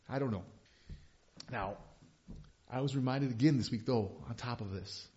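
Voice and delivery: ordinary delivery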